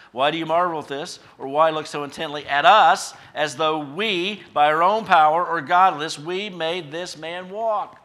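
loud, challenging scriptural questions; forceful preaching. Acted. Peter's challenge preached at full force.

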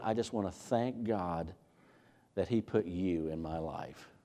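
soft, slow and tender to your wife. Shown as a demonstration. Husband thanking his wife, softly and tenderly. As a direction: gently thank God for her. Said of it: "very tenderly".

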